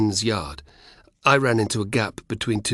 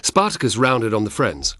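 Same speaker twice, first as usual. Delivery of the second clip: plain reading voice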